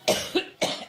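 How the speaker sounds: dry hacking coughs; coughing fit. Real, not acted. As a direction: cough hard, stopping the speech. Coughing at the podium.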